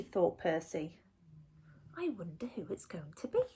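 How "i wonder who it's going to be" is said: fast, hushed whisper. A narrator's reading. Storyteller wondering who knocks, in a quick whisper. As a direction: whisper it to build suspense.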